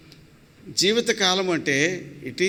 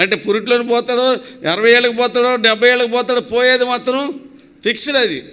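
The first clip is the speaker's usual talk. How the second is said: higher, louder, driving list of possibilities; forceful preaching; 4 semitones higher, 5 dB louder than usual. Real, not acted. Preacher warns congregation that death's day is fixed, forceful preaching. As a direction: pile up each case with mounting insistence.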